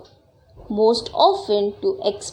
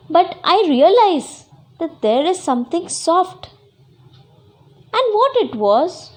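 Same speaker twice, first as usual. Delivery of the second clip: higher, puzzled discovery; acted surprise; 6 semitones higher, 4 dB louder than usual. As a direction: act out a sudden puzzled realisation. Girl discovers something soft.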